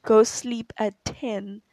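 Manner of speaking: drawn-out slow "Go sleep at ten"; slow mock order. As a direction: spell it out slowly like a bossy adult. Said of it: "someone tells me".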